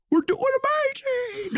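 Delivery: very high, slow, faraway call; voice on the wind. Pretend. Co-host mimicking a faint voice on the wind. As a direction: float it up like a distant voice. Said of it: "we just hear a voice on the wind".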